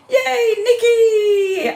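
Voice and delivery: high, slow, stretched cheer; excited greeting. Real, not acted. Host cheers arriving viewer Nikki, high and drawn out. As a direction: squeal hello to a friend.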